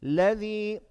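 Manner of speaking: chanted word with a rising, held ending; measured tajweed drill for repetition; Quran recitation. Shown as a demonstration. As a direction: recite it evenly, stretching the last vowel. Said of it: "say the next word".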